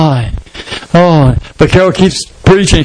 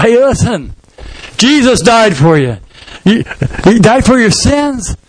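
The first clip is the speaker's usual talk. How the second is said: higher, earnest gospel appeal; earnest pleading; 5 semitones higher than usual. Acted. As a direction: voice lifted high, urging the listeners. Convert pleading with the crowd.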